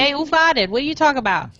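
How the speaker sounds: mock a brash New Yorker's accent; hammed-up New York accent. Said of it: "completely different from New York".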